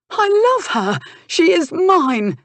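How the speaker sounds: very high, loud cry, slowing on the end; dramatic declaration. Acted. Jealous nephew thumps his chest claiming Kate, dramatic loud cry. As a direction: declare it like a melodrama hero. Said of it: "as dramatic as his mother".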